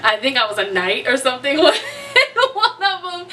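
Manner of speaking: high, real laughter breaking into the words; laughing. Real, not acted. Vlogger recalling her crazy vivid dreams, laughing. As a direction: talk through helpless giggles.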